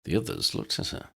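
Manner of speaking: ordinary delivery, nothing marked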